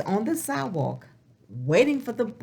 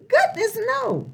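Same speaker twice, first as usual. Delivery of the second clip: much higher, louder bright exclamation; cheerful exclamation; 12 semitones higher, 3 dB louder than usual. Acted. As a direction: toss it off happily, no worries at all. Answering "does Pete worry.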